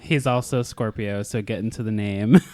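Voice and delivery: usual speaking voice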